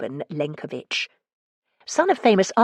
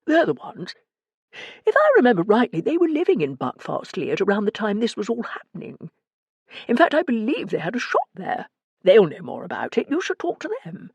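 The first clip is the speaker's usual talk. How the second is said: higher-pitched, lilting character voice; Morris, helpfully recalling; 5 semitones higher than usual. A narrator's reading. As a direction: offer a useful lead, voice pitched up. Narrator voices Morris in dialogue.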